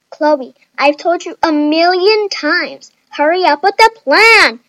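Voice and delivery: loud, high, impatient scolding; exasperated nagging at full voice; annoyed. A narrator's reading. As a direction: scold her, rising to a shout. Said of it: "Nikki said politely and annoyingly".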